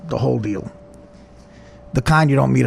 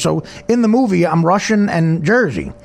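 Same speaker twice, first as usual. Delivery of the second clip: ordinary delivery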